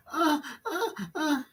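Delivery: high, falsetto mock sex moans; breathy high-pitched imitation moans. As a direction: ham up the moaning, pitched high. Voicing moans during sex.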